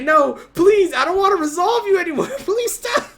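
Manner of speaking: high, whiny mock begging, fast; mock pleading. Host voicing Austin begging the card to stop, mock pleading. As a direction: beg like a terrified victim, hammed up. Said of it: "He's like".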